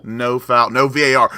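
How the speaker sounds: ordinary delivery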